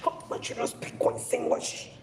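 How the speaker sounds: airy, whispered imitation of a bragging mother; mock proud parent. Mocking parents who brag about English, whispered. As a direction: sneer at the boast in a hushed put-on voice.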